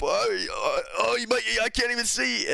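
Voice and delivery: high, strained voice of a fighter in trouble; panicked beaten fighter. Retold or imitated. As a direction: squeal it like you're getting smashed and can't see. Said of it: "And he's like, he's getting beat up".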